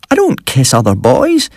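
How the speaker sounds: high-pitched, appalled protest. A narrator's reading.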